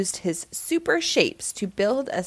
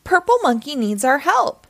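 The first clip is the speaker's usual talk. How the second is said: higher-pitched call for help; urgent exclamation; 5 semitones higher, 4 dB louder than usual. A narrator's reading. Bot alerts the team about Purple Monkey, urgent exclamation. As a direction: sound the alarm for the team. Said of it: "gasped Bot".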